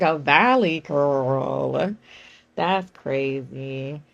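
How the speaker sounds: slow, low, drawn-out incredulity; mock disbelief. Answering a commenter, slow mocking disbelief. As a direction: drag it out, unimpressed. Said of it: "I was like".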